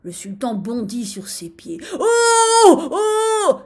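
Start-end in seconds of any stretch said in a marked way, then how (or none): 2.0-3.6 high, loud drawn-out wail; howl of pain. A narrator's reading. Stung sultan leaps up, howling. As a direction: howl out each cry. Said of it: "hurla-t-il".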